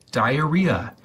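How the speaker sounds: usual speaking voice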